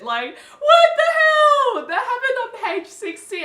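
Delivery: a high, loud, half-laughing shriek; shocked outcry. Real, not acted. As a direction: yell it out in disbelief, laughing. Outburst at disgusting book scene.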